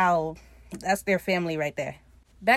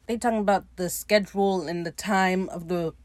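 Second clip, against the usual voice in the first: plain reading voice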